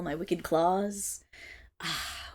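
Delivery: normal talking voice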